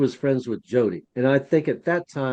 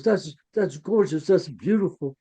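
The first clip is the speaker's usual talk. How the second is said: higher-pitched imitated awe; retold admiration; 4 semitones higher than usual. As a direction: quote his delighted wonder. Retold admiration of restored piece.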